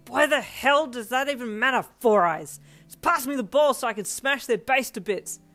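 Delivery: loud, heated outburst; angry holler. A narrator's reading. Bakugo snapping back at Ida, hollering. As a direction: holler in exasperation at a teammate. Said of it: "Bakugo hollered".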